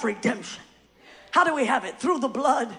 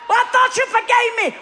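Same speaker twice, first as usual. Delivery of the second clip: pleading sinner; 8 semitones higher, 7 dB louder than usual. Acted. Sinner answering the accuser.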